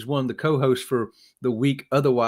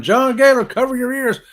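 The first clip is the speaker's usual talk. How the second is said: higher and louder warning call; 9 semitones higher, 6 dB louder than usual. Real, not acted. Warning John before the cowbell, loud call-out. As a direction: call out across the room.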